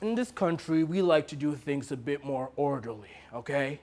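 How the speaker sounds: hushed, patronising firmness; quiet condescension. Acted. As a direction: lay down the rules quietly. Director quietly lays down rules.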